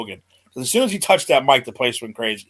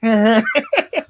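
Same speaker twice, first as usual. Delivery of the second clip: laughing mimic; 9 semitones higher, much slower than usual.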